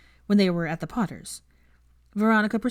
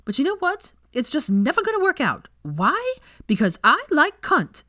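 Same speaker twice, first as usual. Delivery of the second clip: high, loud defiant declaration; blunt defiant punchline; defiant outburst; 10 semitones higher, 6 dB louder than usual. A narrator's reading. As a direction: build up and slam the last line. Punchline of rant.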